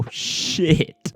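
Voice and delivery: usual speaking voice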